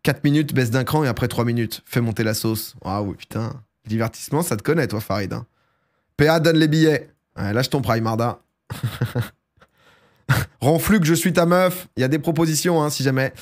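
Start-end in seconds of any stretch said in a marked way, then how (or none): none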